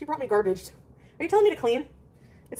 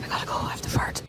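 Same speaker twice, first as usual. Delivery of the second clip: whisper; 5 dB quieter than usual. Real, not acted. Whispered confession on stream.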